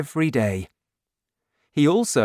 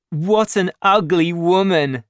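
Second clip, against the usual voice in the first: louder, higher sneering taunt; mocking jeer; 6 semitones higher, 5 dB louder than usual. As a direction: jeer at her like a cruel young man. Young man laughs at Fantine.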